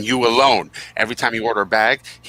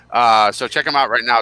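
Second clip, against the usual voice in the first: normal talking voice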